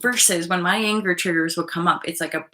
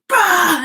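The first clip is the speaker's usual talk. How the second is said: sharp, loud, high burst of a sound; loud bah; 11 semitones higher, 10 dB louder than usual. Retold or imitated. Acting out cutting the person off, loud bah. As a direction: spit out a dismissive blast.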